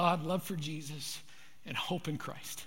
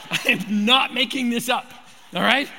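emphatic; 4 semitones higher, 7 dB louder than usual. Real, not acted. Laughing insistence after the story.

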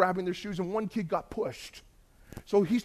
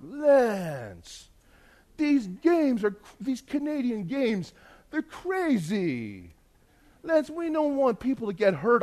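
put-on high, slow, accented complaint; foreign camp leader; 6 semitones higher, much slower than usual. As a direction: imitate a grave leader, stretching each word.

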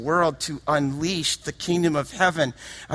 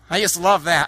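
ordinary talk, nothing marked

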